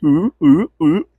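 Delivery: high, loud cartoon ghost wail; spooky ghost ooh. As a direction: hoot it like a Scooby-Doo ghost. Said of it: "Felt like Scooby-Doo and the whole gang walking down like".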